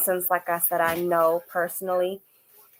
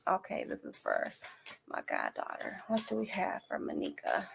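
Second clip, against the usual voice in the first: whispered aside, no voice at all; 14 dB quieter than usual. Real, not acted. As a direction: breathe the words as a private aside. Private aside before reading.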